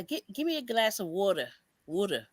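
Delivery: Philly "water" as "wooder"; imitating a Philadelphia accent. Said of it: "They say".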